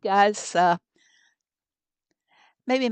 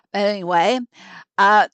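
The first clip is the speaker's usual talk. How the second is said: ordinary talk, nothing marked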